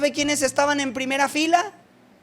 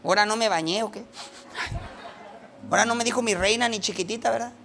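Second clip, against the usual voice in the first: puzzled housewives; 5 dB quieter, faster than usual. Retold or imitated. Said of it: "las señoras así de".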